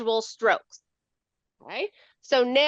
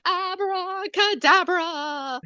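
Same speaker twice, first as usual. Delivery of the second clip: puppet voice; 3 semitones higher, much slower than usual. Pretend. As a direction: present the magic sea bunny like a puppet. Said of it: "And here comes my guest".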